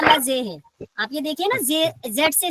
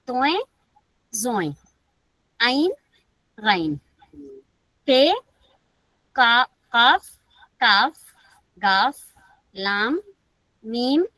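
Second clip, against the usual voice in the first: reciting the alphabet; slower than usual. Acted.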